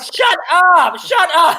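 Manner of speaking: high, loud yelled protest; hammed-up panicky yell; exasperated shout. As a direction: shriek at a friend to stop. Retells hushing over-eager friend.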